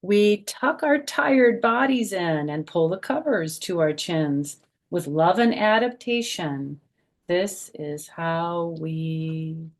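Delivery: bouncy rhyming picture-book voice; reading to children. Acted. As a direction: read the rhyme aloud to a group of little kids. Reads rhyming picture book aloud.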